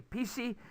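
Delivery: "pishi" pitched high, close to "P C"; Konglish pronunciation. Shown as a demonstration. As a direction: say "pishi" high, as two Korean syllables. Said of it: "P C is getting close to personal computer".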